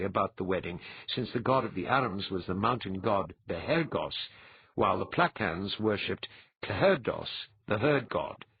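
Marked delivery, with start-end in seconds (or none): none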